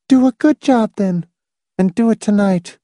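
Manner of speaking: high woman character voice; Miss Rice's voice. Acted. Miss Rice handing Hamilton the job, high character voice. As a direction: give the order in her high pitch.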